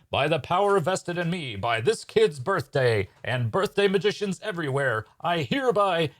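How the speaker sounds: high, pompous stage magician; grand proclamation. Acted. Magician invoking birthday powers before dueling, grand proclamation.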